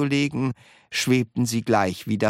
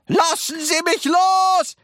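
high, loud, drawn-out yell; roaring; 5 dB louder than usual. A narrator's reading. Wonka in grandma's grip, roaring to be released. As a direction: bellow it slowly at high pitch. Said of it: "brüllte Mister Wonka".

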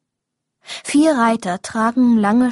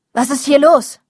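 alarmed question; 3 semitones higher, 5 dB louder than usual. A narrator's reading. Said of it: "fragt Lou".

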